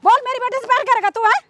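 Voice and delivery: outraged mother's tirade; scornful, indignant scolding; angry scolding. Acted. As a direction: berate him with indignant questions. Acted mother challenges servant.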